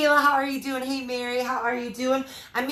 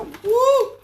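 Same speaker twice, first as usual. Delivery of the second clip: startled woo; 12 semitones higher, 8 dB louder than usual. Real, not acted. Nearly knocking camera, grabbing egg.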